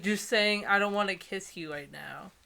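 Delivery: ordinary talk, nothing marked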